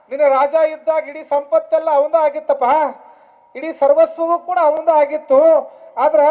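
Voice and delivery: preacher in full voice; forceful preaching. Acted. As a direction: drive the moral home to the crowd.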